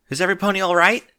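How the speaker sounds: high, loud and fast; hurried concern. A narrator's reading. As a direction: rush the question out. Rainbow checking in a rush.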